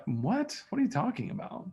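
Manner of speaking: put-on high, soft baffled teenage girl; puzzled daughter interrupting dad's story, high and soft; confused daughter.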